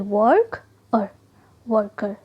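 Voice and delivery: वर्क then वर्कर said as separate parts; syllable split. Shown as a demonstration. Teacher showing syllable breaks to a class, carefully. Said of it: "आर के को हम अलग नहीं रख सकते".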